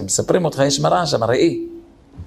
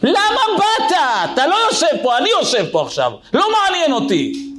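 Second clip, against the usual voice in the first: high, indignant yell; impatient customer shouting in the barbershop; angry yelling; 11 semitones higher, faster than usual. Retold or imitated. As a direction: scream that you're sitting here now. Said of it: "צרח עליו".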